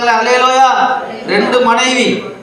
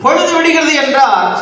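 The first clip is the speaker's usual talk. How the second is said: declaimed scripture; 6 semitones higher than usual. Real, not acted. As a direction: declaim the verse to the congregation.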